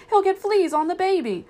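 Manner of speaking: high, loud scolding mimicry; mock fussing owner. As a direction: fuss loudly in a high mocking voice. Tramp mimics fussing humans.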